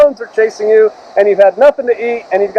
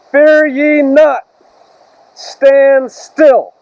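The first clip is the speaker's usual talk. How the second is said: slow, weighty reading of scripture; solemn scripture reading; slower than usual. Acted. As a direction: lay each phrase down slowly, like a prophet. Reads Moshe's scripture words solemnly.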